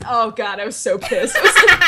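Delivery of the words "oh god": normal talking voice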